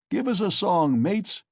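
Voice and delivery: loud, high call to mates; shouted call. A narrator's reading. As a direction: call out lustily for a song. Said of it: "shouted someone in the cart".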